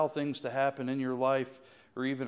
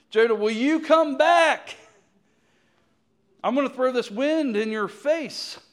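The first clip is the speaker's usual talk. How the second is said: much higher, loud booming call; God calling out; 10 semitones higher, 8 dB louder than usual. Acted. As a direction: call him home like a stern God.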